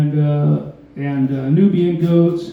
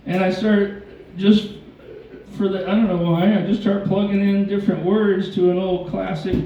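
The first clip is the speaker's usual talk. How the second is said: ordinary talk, nothing marked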